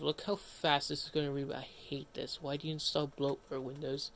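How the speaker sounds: normal talking voice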